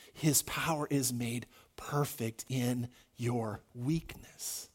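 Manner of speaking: low, soft, slow divine answer; God answering Paul gently, low and quiet. Acted. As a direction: speak as God, calm and deep. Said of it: "and God said".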